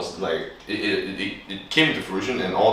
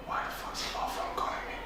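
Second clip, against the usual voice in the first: breathy laughing hush, words half swallowed; laughing whisper; 19 dB quieter, faster than usual. Retold or imitated. To friends, mocking the scam caller, laughing whisper. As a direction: giggle the line under your breath. Retelling a suspicious scam call.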